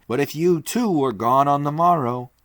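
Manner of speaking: a resolute man declaring the plan; firm Leo. Acted. Leo Mathienne asks.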